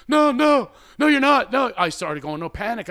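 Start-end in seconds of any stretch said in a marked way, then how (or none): 0.1-1.7 high, loud frantic no no no; panicked protest. Retold or imitated. To her friend who thinks she's bored, panicked pleading. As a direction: protest in a panic so she won't leave. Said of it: "And I'm go".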